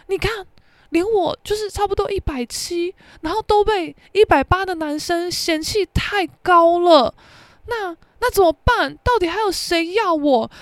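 high, mock-pitiful whining; a touch of self-pity, high; whiny complaint. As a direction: whine like a wounded friend. Classmate whines about height.

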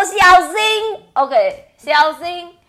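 loud high call of warning; warning shout. Retold or imitated. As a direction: shout up to him. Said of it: "跟那个Pick the Puck说".

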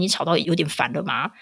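ordinary talk, nothing marked

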